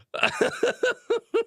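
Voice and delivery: high staccato giggle; high laugh. Real, not acted. Streamer laughing at comments, high giggle. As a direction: let out a high, real giggle.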